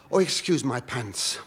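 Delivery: ordinary talk, nothing marked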